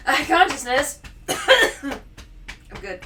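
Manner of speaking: real coughing fit mid-phrase; choking. Real, not acted. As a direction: push words out between coughs. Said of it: "co-host is choking right now".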